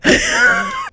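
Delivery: very high awkward giggle; squeaky mock laugh; nervous high laugh. Mocking his reaction.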